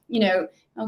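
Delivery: usual speaking voice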